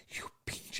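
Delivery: whispered, breathy curse; mock whispered mom curse; hissed insult. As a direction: hiss it under your breath at your son. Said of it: "She goes".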